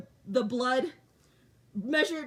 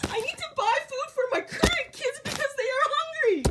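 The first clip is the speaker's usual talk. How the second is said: retold crying; 10 semitones higher than usual. Mother on the phone in the checkout line, crying. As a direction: replay the sobbing plea at the checkout. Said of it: "I was crying".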